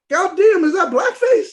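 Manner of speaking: high, loud mock disbelief; shocked outburst. Viewer reacting to her early videos, shocked outburst. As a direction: blurt it out like an appalled viewer.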